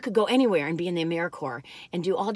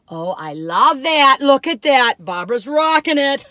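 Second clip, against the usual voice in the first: delighted; 4 semitones higher, 9 dB louder than usual. Real, not acted. Admiring her earring in mirror.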